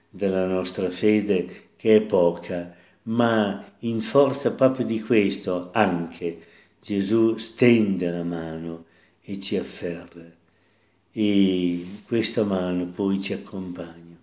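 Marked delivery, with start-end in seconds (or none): none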